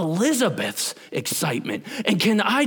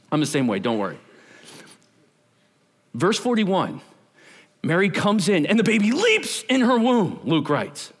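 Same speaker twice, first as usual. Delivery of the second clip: normal talking voice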